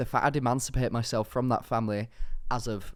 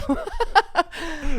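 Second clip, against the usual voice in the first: high laughter; 6 dB louder than usual. Real, not acted. Laughing at the story.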